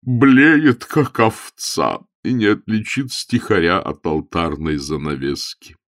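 mildly bitter complaint. A narrator's reading. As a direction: start loud and high on the bleating, then settle. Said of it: "горько добавил епископ".

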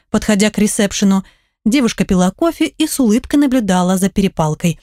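usual speaking voice

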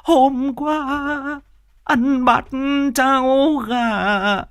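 high, slow mock lament with sobs; hammed-up wailing. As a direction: wail over the body like a hired mourner. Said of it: "mếu máo". (khóc mếu máo giả vờ, kéo dài)

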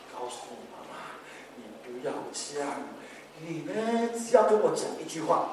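murmured low and soft, almost unvoiced; hushed request. To his mother, hushed and confiding. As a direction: hush it down like a quiet word. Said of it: "我就跟我妈妈说".

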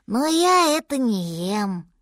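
put-on slow, mildly plaintive child voice; lightly voiced little mammoth declining; drawn-out small-child refusal; baby mammoth.

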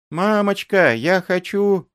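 put-on high childish pleading; pleading girl. A narrator's reading. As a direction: beg mama to stay.

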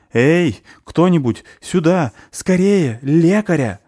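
urgent cry for help; shouting for help. A narrator's reading. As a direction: yell for a healer as he catches the falling goblin. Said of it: "закричал подхвативший его Полчек".

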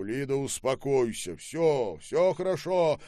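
loud, rising, insistent reassurance; agitated calming. A narrator's reading. Husband calming his hysterical wife, agitated insistence. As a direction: insist over her outcry that it's over.

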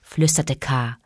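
low, soft hiss of a serpent; hissing snake. A narrator's reading. Snake Kaa whispering his plan.

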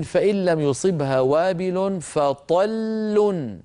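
slow chanted recitation of the verse; measured Quran chanting; Quranic recitation. Shown as a demonstration. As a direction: recite it slowly in tajwid style. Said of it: "في الآية خمسة وستين".

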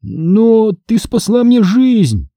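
high, drawn-out objection; pleading high-pitched protest. A narrator's reading. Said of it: "возразил я".

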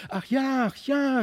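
put-on high, delighted child voices; eager cry. A narrator's reading. The two children eagerly accepting the run, high and excited. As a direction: jump at the offer with joy. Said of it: "riefen beide".

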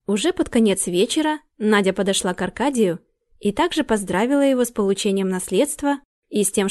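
ordinary delivery, nothing marked

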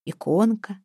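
ordinary delivery

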